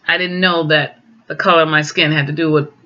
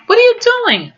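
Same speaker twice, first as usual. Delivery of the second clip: much higher, indignant question; retold shocked outburst; 4 dB louder than usual. Ivy confronting coworkers over the trashed application, shocked. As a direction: react in disbelief at the trash can.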